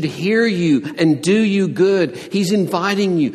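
loud, driving preacher's climax; fervent loud sermon delivery; preaching at full force. Acted. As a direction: build it like a pulpit climax.

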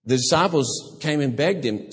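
usual speaking voice

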